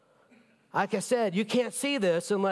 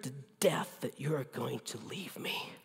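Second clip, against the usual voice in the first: retold whisper; 7 semitones lower, 9 dB quieter than usual. Confessing fear to his wife.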